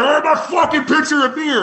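high-pitched, indignant mock outburst; irate customer. Irate customer demanding his pitcher of beer from the bartender, high mock outburst.

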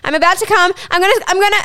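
a light high-pitched take on her building up; imitating partner climaxing. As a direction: lightly voice her rising urgency.

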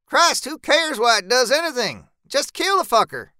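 high, impatient outburst; exasperated snapping. A narrator's reading. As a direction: snap in irritation, pitch well up. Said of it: "Zeb snaps".

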